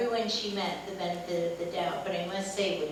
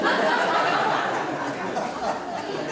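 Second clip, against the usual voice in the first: louder airy laughter; loud breathy laugh; 7 dB louder than usual. Real, not acted. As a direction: laugh freely at the story. Laughs after the anecdote.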